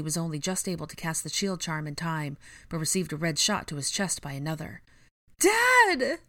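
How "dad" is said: very high, loud cry; shriek. A narrator's reading. Ginny sees her father hit, shrieking. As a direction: shriek it out in alarm. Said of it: "Ginny shrieked".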